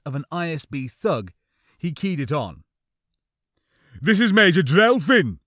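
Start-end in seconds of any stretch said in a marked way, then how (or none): 4.0-5.4 loud, higher officer's voice; Drelfin. A narrator's reading. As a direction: announce yourself forcefully as an impersonated officer. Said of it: "his best impression of Drelfin's voice".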